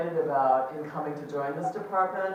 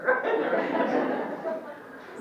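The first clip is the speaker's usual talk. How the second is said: a long airy giggle, high and rising; breathy high laughter; 12 semitones higher than usual. Real, not acted. Chair laughing at her own plaque mix-up, airy high giggle. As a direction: laugh helplessly at the slip. Laughs after joke title.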